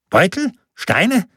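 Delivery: high, puzzled questioning; confused official, each word a question; baffled. A narrator's reading. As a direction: echo it in high-pitched disbelief. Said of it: "sah sehr verwirrt aus".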